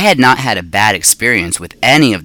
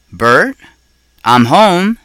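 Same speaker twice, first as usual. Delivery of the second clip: higher, louder call to the next room; 7 semitones higher, 4 dB louder, slower than usual. A narrator's reading. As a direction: call out across the house. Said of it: "Missus Brisbane called out".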